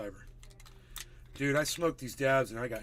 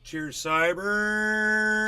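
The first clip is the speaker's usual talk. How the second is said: much higher, louder, very slow toast; drawn-out cheers; 8 semitones higher, 11 dB louder, much slower than usual. Real, not acted. Toasting a viewer on the hour, loud and stretched.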